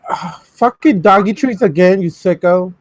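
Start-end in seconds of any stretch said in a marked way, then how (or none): none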